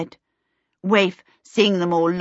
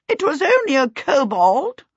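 higher, brisk aunt voice; dismissive aunt; 8 semitones higher, 4 dB louder than usual. A narrator's reading. Aunt Sempronia reproving frightened Missus Baker, dismissive. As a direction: wave away someone's fright.

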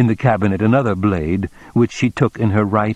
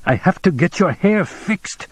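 high-pitched, despairing plea; 10 semitones higher than usual. A narrator's reading. Man pleading with the woman to get ready, despairing. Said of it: "he said in a despairing voice".

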